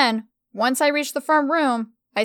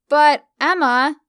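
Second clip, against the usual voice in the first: loud drawn-out protest on But; 4 semitones higher, 6 dB louder, slower than usual. A narrator's reading. Stella objects to Emma, loud and drawn out. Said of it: "she rejected".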